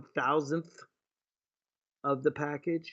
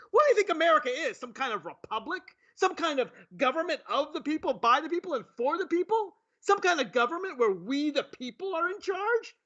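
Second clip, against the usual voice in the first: higher mock indignation; mocking the host's anger, sarcastic; sarcastic outrage; 7 semitones higher than usual. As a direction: ham up her outrage sarcastically. Sarcastic mock of angry host.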